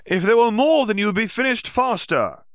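put-on a young student blurting out a worried question. Student questions Yaxley loudly, then trails off unsure.

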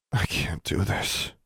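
soft, slow inner despair; despairing thought. A narrator's reading. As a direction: close to sobbing, to yourself. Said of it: "she thought".

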